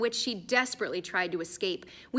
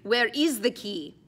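demanding warrior; 3 semitones higher, 6 dB louder, slower than usual. Acted.

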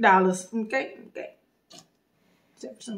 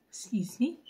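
whispered apology; 11 dB quieter than usual. Real, not acted. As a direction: whisper a quick excuse me. Quiet aside.

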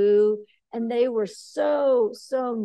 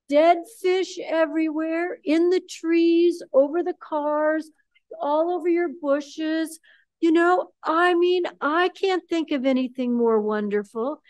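gleeful; 5 semitones higher, 4 dB louder than usual. Real, not acted. Gleeful fish-tsunami description.

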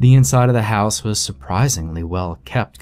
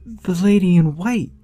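put-on high, hushed little girl voice; whispering child; 9 semitones higher than usual. A narrator's reading. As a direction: whisper it like a scared small child. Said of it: "child voice in story".

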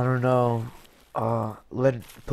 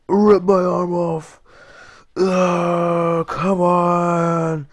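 high, loud, drawn-out straining; frustrated yell; 7 semitones higher, 7 dB louder, much slower than usual. Real, not acted. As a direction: groan it out loudly, fighting to get free. Struggling with stuck hand.

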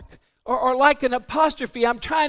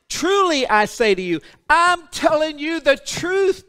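emphatic declaration; 4 semitones higher than usual. Acted. Preacher voicing Jesus to the people, emphatic. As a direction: build to a forceful pulpit cry. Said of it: "That's what Jesus is saying".

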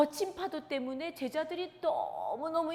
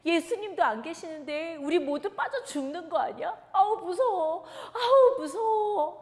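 high, loud, panicky wail; frightened disciples; 5 semitones higher, 6 dB louder than usual. Acted. Disciples in the storming boat crying out in terror. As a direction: cry out in fear of drowning. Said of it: "하고 모두 겁내하고 있었어요".

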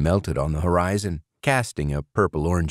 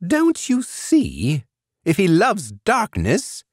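much higher, slow, trembling; quavering voice; 13 semitones higher, 4 dB louder, slower than usual. A narrator's reading. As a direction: let the voice wobble with hope. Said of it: "Burgum said, voice quavering".

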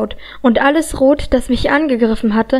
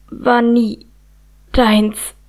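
hoarse, choked out; much slower than usual. A narrator's reading. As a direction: squeeze out each word with effort. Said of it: "wirkte Rook heiser hervor". (heiser, stockend hervorgepresst)

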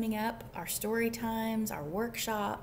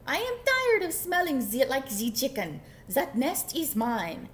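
put-on loud, indignant French accent; storybook duck, fake French accent; French-accented duck cry; 4 semitones higher, 6 dB louder than usual. A narrator's reading. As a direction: complain in a hammed-up French accent. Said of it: "cried the duck".

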